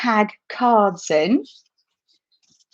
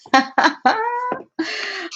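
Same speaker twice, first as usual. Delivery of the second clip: a burst of high laughter after the reading; delighted laugh at the story's punchline; 9 semitones higher than usual. Real, not acted.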